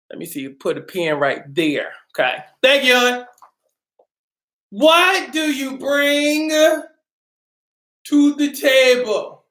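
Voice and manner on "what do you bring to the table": drawn-out question. Acted. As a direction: drag the question out for drama.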